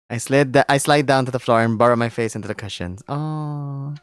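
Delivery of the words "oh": ordinary delivery, nothing marked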